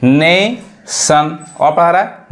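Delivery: Nation said slowly, its अ sound held; walk through nation slowly; teacher slowing down on nation; slow teaching explanation. Shown as a demonstration. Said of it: "जैसे motion".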